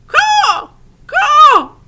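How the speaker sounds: very high, loud crow caws; crow screaming. A narrator's reading. Crow screams in Edward's ear, loud high caws. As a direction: screech like a crow. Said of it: "said the Pellegrina crow".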